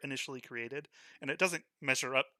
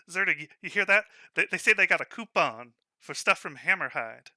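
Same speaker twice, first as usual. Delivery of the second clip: mocking amusement; 4 semitones higher, 9 dB louder than usual. Shopkeeper mocking to his drone.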